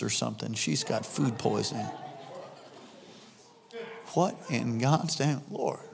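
low, hushed disbelief to oneself; stunned muttering. Acted. Man reacting to her pregnancy news, hushed disbelief. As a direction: mutter it low and soft, baffled.

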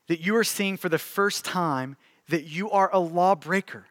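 hushed pastoral appeal. Acted. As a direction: draw the room quietly into prayer. Soft closing and call to prayer.

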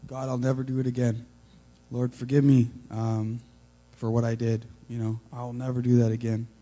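loud, fast pleading prayer to God. Retold or imitated. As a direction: beg forgiveness urgently.

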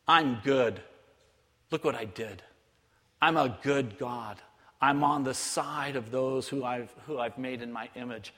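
low, soft, measured reassurance; gentle voice of God. Retold or imitated. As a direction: speak low and tenderly as a good God. Voices God speaking through scripture.

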